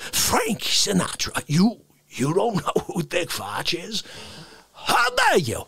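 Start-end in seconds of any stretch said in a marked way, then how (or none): 4.7-5.6 high-pitched indignant yelp. Acted. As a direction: shriek it like outraged diva. Catchphrase high.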